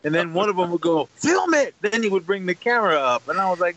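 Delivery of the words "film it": shouted order. Retold or imitated. A character ordering the cameraman.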